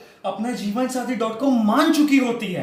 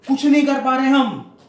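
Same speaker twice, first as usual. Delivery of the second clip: loud, higher exasperated cry; frustrated shout; 5 semitones higher, 6 dB louder than usual. Acted. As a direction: burst out in helpless frustration. Climax of frustrated rant to interviewer. (हताशा में चिल्लाकर)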